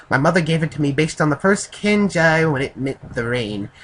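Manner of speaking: a badly faked Japanese accent; Japanese accent attempt. Narrator voices Amaya telling of his mother's choice, Japanese accent. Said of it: "I can't do a Japanese accent".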